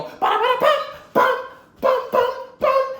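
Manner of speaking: high, loud vocal percussion; punchy "bum" impact noises; imitated fight hits. Narrator voices the blows of the fight, loud percussive hits. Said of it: "they go".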